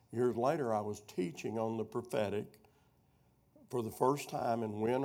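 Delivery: ordinary talk, nothing marked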